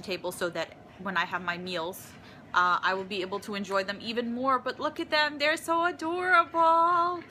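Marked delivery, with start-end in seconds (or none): 4.6-7.2 high, louder delighted gushing. Real, not acted. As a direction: coo over them, delighted. Gushing over owl shakers.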